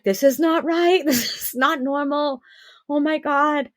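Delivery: panicked. Retold or imitated. Panic after her water broke.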